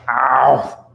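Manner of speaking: sudden ow of pain. Real, not acted. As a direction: yelp from a stubbed toe. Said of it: "Sorry, I just, like, stubbed my toe".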